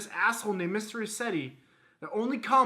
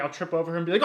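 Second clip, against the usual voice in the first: ordinary talk, nothing marked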